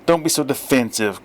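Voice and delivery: usual speaking voice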